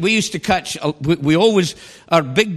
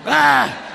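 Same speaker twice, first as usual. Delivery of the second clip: laughing exclamation; 8 semitones higher than usual. Real, not acted. Laughs at own joke.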